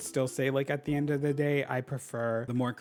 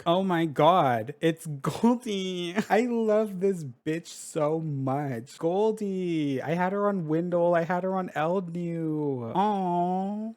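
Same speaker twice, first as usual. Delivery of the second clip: higher, drawn-out delighted squeal of affection; excited gushing; 5 semitones higher, 3 dB louder, slower than usual. Real, not acted. Player reunites with a beloved villager, excited gushing. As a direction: gush fondly, stretching the words out.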